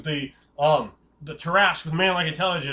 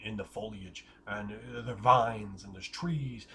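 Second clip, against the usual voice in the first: slow, low, hushed descriptive narration; game master scene setting; 6 semitones lower, 5 dB quieter, slower than usual. Acted. Game master describing the jungle trail to players, in a slow, low, hushed narration. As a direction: draw the players into the misty jungle, unhurried.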